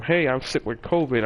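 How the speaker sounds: ordinary talk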